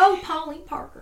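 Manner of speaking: put-on Southern accent. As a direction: croon Pauline Parker like a Southern belle, Southern accent. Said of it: "I love speaking in a Southern accent".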